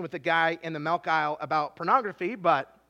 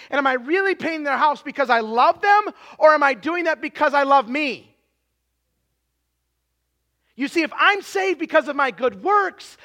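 louder, higher, driving sermon delivery; full-force preaching; 6 semitones higher, 10 dB louder than usual. Real, not acted. Preacher urging the congregation about God's love, full-force preaching. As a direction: preach it forcefully, hitting the key words hard.

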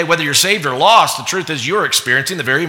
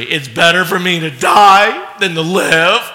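sulky Jonah; 2 semitones higher, 4 dB louder than usual. Acted. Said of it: "he's telling him in verse three".

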